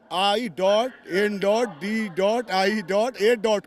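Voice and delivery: slow, deliberate letter-by-letter delivery; mocking spelling-out. Real, not acted. Party leader mocking rival INDIA alliance to reporter, slow and pointed. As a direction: spell it out slowly, dot by dot.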